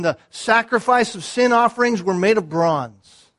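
full-voiced sermon climax; preaching at full force. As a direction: thunder it from the pulpit, building.

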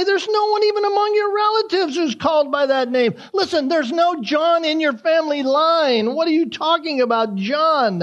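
high, incredulous mock protest; hammed-up disbelief at the name; shocked relatives. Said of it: "They're so shocked that they even say".

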